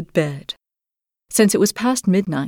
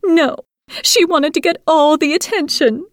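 shrill sisters; 10 semitones higher than usual. A narrator's reading. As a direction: whine like a spiteful sister. Sisters complain.

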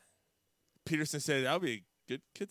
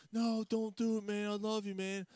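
high-pitched mock pleading; hammed-up high, urgent plea; imitated pleading friend; 9 semitones higher than usual. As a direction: beg him to stop, voice pitched up.

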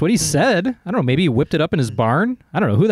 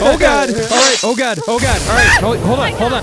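high, raised-voice alarm; flustered panic; 8 semitones higher, 4 dB louder than usual. Acted. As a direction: repeat yourself frantically amid chaos. Acted panic amid chaos.

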